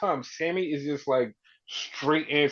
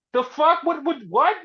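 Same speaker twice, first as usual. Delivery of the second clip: high, incredulous mock yelp; baffled; 12 semitones higher, 5 dB louder than usual. Punk hearing he headlined, baffled high disbelief. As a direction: voice leaps high in total disbelief. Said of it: "he's so fucking baffled".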